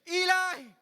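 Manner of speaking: a full, loud anguished cry. Acted. Preacher voicing Jesus on the cross, crying loud. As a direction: call out with everything left. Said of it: "loud voice to kasi".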